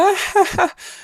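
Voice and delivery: very high puppet giggle; squeaky Muppet laugh; Elmo giggle. Retold or imitated. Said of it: "And Elmo's just like".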